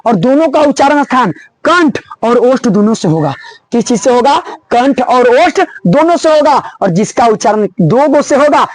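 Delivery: usual speaking voice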